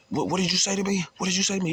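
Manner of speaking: high, pushy retold challenge from a guard; mock angry challenge. Guard confronting the inmate outside the cell, mock high angry challenge. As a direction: play the guard squaring up, pitched high.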